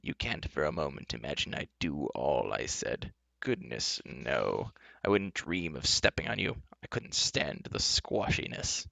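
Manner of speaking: soft, low murmur into the ear; hushed confiding. Acted. Enchantress whispering to Taryn, hushed reassurance. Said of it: "whispering in Taryn's ear".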